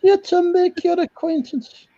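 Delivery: prim nanny in a high voice; Mrs Doubtfire voice. Pretend. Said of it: "it's Missus Doubtfire here".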